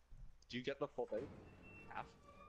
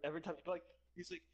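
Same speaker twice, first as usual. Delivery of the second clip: ordinary delivery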